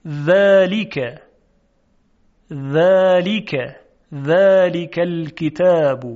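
ذلک very slowly, with a thin dental dhal and long first vowel; delicate dhal. Shown as a demonstration. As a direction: draw out ذلک, keeping the dhal light. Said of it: "شما هم تکرار کنید". (ذلک خیلی کشیده با ذال ظریف)

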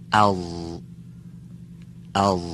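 rounded mouth on ال; heavy letter. Shown as a demonstration. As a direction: say ال heavy and dark.

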